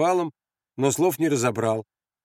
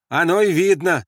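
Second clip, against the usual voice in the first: high, raised comeback; loud retort; 6 semitones higher, 6 dB louder than usual. Acted. Andres answering the sentry's remark, loud retort. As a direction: call it straight back.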